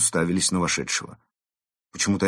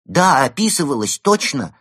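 eager, raised and loud; excited exclaim; 8 semitones higher, 7 dB louder than usual. Acted. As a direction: blurt it out with enthusiasm. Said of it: "воодушевлённо воскликнул Евгений".

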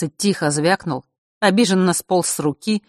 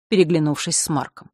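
ordinary delivery, nothing marked

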